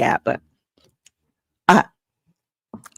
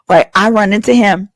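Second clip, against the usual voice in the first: ordinary talk, nothing marked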